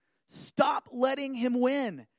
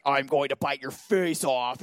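low, slow mock monster growl; 8 semitones lower than usual. Pastor mocking the bedtime monster idea of Satan, low monster voice. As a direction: play a face-eating night monster. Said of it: "like a monster".

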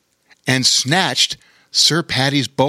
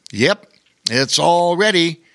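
higher, put-on wizard voice; casual wizard; 5 semitones higher than usual. A narrator's reading. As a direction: confirm it as a contented storybook wizard. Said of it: "he said, licking blackberry pie".

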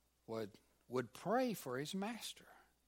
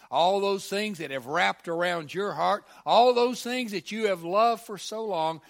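much louder, higher pleading build; full-force preaching; 7 semitones higher, 16 dB louder than usual. Real, not acted. Preacher loudly urging the congregation to let go. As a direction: swell into a loud, pleading climax.

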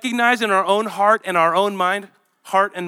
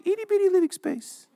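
high squeezed genie voice from Aladdin; genie impression; 11 semitones higher than usual. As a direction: squeak it like the cartoon genie. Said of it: "It reminds me of Aladdin".

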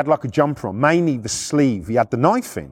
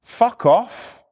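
higher-pitched mock challenge; retold confrontation; 7 semitones higher than usual. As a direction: act out squaring up to someone. Retold confrontation with hostile man.

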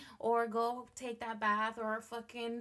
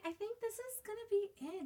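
normal talking voice

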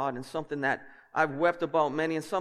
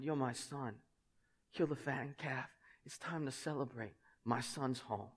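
tender father; 3 semitones lower, 12 dB quieter than usual. Acted. Father welcomes the lost son.

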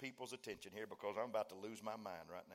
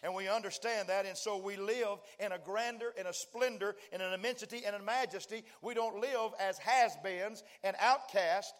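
ever louder, higher sermon climax; preaching at full force; 7 semitones higher, 8 dB louder than usual. Acted. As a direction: build it to a roaring peak. Preacher's climax keeps building louder.

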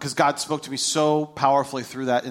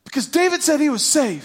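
high, indignant imitation of an angry son; high-pitched mock outburst; mad kid; 10 semitones higher, 3 dB louder than usual. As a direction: protest like a seething kid. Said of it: "He's not pouty mad".

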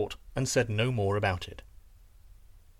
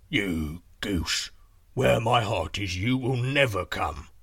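slow giant voice; scoffing giant; 4 semitones lower, slower than usual. Acted. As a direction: tease her ponderously as the giant.

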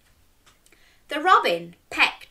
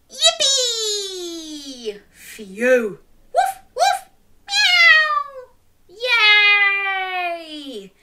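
high cheering voices; celebrating; 8 semitones higher, 4 dB louder, much slower than usual. Acted. Everyone celebrating the turnip coming out, cheering. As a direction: cheer as it pops out.

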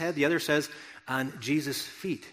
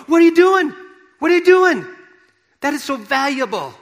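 much higher and louder, aghast yelling; shocked outcry; 12 semitones higher, 11 dB louder than usual. Retold or imitated. Onlookers shouting at Mary pouring perfume on Jesus, aghast. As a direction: cry out in horror at the waste.

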